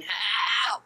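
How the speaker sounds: imitated high, drawn-out cry for help; high-pitched panicked shout, acted out; screaming for help. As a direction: yell it out as if stranded on a lake. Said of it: "He starts screaming".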